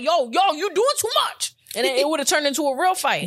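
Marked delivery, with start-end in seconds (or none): 0.0-1.5 warning yo. Retold or imitated. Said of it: "I would have been like".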